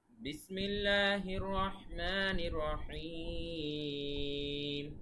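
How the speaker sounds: slow Quranic chant; Quran recitation. Shown as a demonstration. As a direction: draw out each phrase like recitation. Recites Quran opening slowly.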